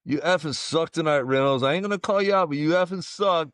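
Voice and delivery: put-on heckling fan in thick New York accent; brash New York heckle. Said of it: "in a full New York accent was like".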